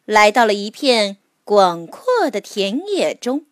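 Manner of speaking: ordinary delivery, nothing marked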